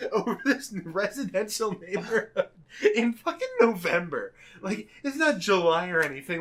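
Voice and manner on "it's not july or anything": usual speaking voice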